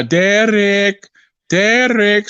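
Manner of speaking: lightly put-on Russian accent, calling his opponent's name; mock Russian accent. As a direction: imitate the Russian boxer teasing in a Russian accent.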